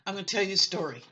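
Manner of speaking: ordinary delivery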